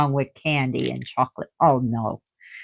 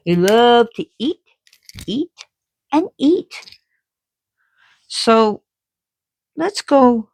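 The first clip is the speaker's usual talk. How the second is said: building excitement; 8 semitones higher, 5 dB louder than usual. Real, not acted. Building excited list of eating.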